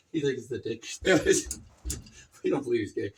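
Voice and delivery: ordinary talk, nothing marked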